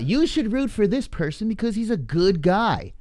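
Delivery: high mocking preachy voice; high-pitched mock lecture; mocking quote. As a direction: parody a sanctimonious fan. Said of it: "People out".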